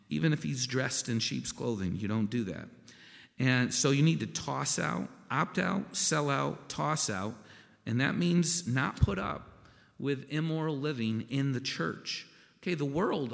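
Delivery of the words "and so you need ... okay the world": higher, louder preaching build; preaching at full force. Acted. Preacher urging the church to toss out sin, full-force preaching. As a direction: drive the list home to the congregation.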